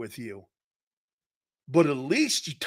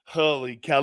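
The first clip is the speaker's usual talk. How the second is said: ordinary talk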